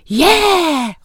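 high, loud happy cheer; excited cheer. A narrator's reading. Children cheering about the outing, excited shout. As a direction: cheer like kids let out of class. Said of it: "råbte børnene".

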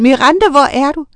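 high, loud anxious call; shouted. A narrator's reading. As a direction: call out for a missing daughter. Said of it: "råbte Uline".

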